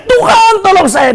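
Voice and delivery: acted shout for help, starting high; hammed-up plea to be rescued; crying out. Drowning believer cries to God for help, crying out. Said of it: "kalau dia teriak".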